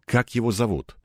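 usual speaking voice

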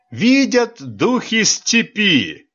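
high, slow, hammed-up mirth; theatrical laughing. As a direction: wipe tears and wail it out. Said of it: "демонстративно вытирал обильные слёзы". (нарочито, сквозь слёзы смеха)

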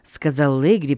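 ordinary delivery

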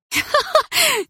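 high, loud laugh with no joy in it; ringing joyless laugh. A narrator's reading. Polina laughs at Dima's lie, bitter ringing laugh. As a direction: laugh sharply out of anger. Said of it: "рассмеялась я звонко".

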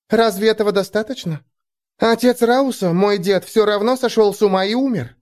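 put-on high young girl asking. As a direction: ask up brightly, like a girl. Elfie voiced by narrator.

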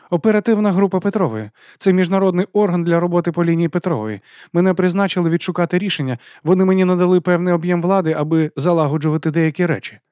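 high, firm official explanation; formal woman official. A narrator's reading. Official explaining her authority to a puzzled teacher, businesslike.

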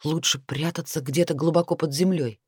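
usual speaking voice

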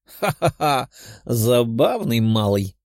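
laughing. A narrator's reading. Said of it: "рассмеялся капитан".

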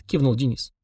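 usual speaking voice

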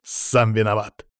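slow, restrained growl. A narrator's reading. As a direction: growl it out before striking. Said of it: "прорычал".

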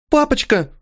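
high tearful cry for daddy; sobbing child calling out; crying little girl. Acted. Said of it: "рыдающая красавица".

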